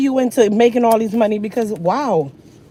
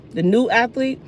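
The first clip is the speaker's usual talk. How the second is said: ordinary delivery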